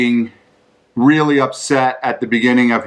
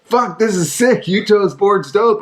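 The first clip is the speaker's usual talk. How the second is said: imitating a hyped buddy; 8 semitones higher than usual. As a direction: ham up the friend's amazed praise. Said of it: "Pete Eldridge going".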